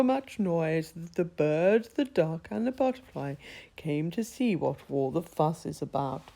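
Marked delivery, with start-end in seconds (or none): none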